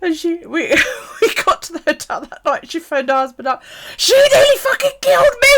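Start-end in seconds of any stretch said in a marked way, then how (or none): 4.0-5.5 loud, high-pitched imitated complaint; mock outraged wail. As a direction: wail it down the phone to a husband. Friend's complaint to husband acted out.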